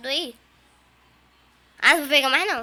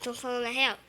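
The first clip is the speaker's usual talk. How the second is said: usual speaking voice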